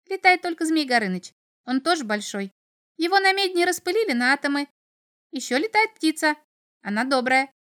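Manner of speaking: high, piping imp voice; chattering little devil. A narrator's reading. Little devil explains who can fly, chirpy. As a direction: prattle on in a small high voice. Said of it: "пропел чертёнок".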